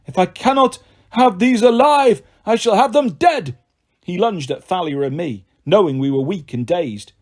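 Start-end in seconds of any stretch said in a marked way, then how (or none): 0.1-3.6 higher, louder snarling monster voice; monster growl. Acted. The manticore growls a threat at the heroes, high and menacing. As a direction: threaten like a cornered beast. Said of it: "The manticore growled".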